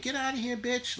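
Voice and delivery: high squeaky mock voice of a hipster woman. Mocking hipster women shooing him, in a high squeaky voice. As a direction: squeal it like a prissy hipster chick.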